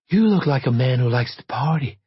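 lightly voiced flirtatious invitation; a hint of coy hopefulness; hopeful come-on. A narrator's reading. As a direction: make a hopeful pass at a stranger. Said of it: "she said hopefully".

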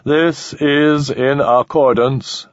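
very slow cold alien; measured inhuman calm. Acted.

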